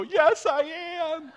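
high, drawn-out cry of relief; overjoyed relief. Retold or imitated. Lost son answering the man with a flashlight, overjoyed cry. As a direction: call back high, stretching the words.

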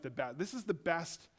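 normal talking voice

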